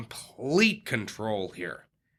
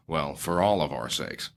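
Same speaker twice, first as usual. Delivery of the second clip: ordinary talk, nothing marked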